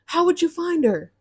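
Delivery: retold outrage. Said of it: "So I get so mad because I'm like".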